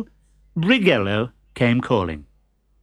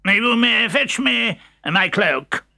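pompous doctor; 9 semitones higher, slower than usual. Acted.

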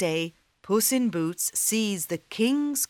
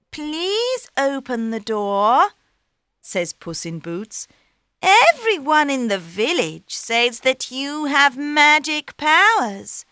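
cat voice; 6 semitones higher, 6 dB louder than usual. Acted. Cat flatters ogre.

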